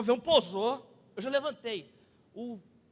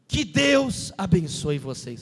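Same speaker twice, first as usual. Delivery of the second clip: slow, louder blessing pitched down; pilot's blessing; 5 semitones lower, 6 dB louder, slower than usual. Retold or imitated. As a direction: imitate a captain solemnly blessing passengers. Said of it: "no final ele falou assim".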